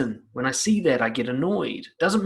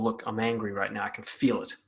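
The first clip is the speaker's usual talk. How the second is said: lower, softer, calm statement; calmo; 5 semitones lower, 6 dB quieter than usual. Shown as a demonstration. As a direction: say it as calmly as possible. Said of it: "as calmly as possible".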